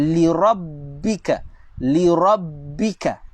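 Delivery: rabbika slowly with a held bb; doubled b. Shown as a demonstration. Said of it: "Baca dengan".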